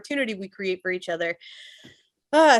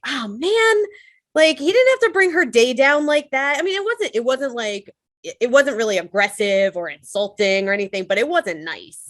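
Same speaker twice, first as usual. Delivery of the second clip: higher, heated disbelief; fuming about the rude customer, pitched up; indignant inner rant; 6 semitones higher, 3 dB louder than usual. Retold or imitated. Replaying the exchange in her head, higher and indignant. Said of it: "I'm getting angry".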